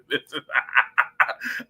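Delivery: amused burst of laughter; real laugh. Real, not acted. Speaker amused by viewer's joking comment, laughing. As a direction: crack up laughing.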